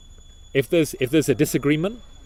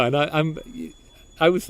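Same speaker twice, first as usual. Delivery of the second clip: normal talking voice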